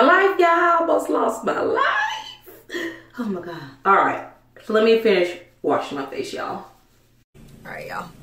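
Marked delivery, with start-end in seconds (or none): 0.0-1.8 high, laughing y'all, almost lost my life; laughing exclaim. Real, not acted. Vlogger laughing to viewers about almost losing it. Joking about near disaster. 7.6-8.1 low, whispered all right, y'all; soft whisper. Real, not acted. Vlogger whispering a sign-off to viewers. Wrapping up, going quiet.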